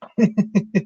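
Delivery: high laugh. Real, not acted. Teasing a viewer.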